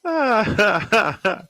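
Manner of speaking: high-pitched burst of laughter; real laugh. Real, not acted. Broadcaster amused by a listener's message, laughing.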